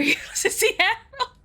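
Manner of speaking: high laughter through the words; laughing. Real, not acted. Streamer laughing at the narrator's accent, high and giggling. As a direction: crack up while saying it.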